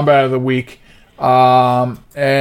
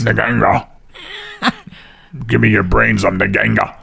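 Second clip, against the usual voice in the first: lightly voiced zombie; a hint of a low zombie growl; 3 semitones lower than usual. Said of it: "Naganga sounds like a zombie name".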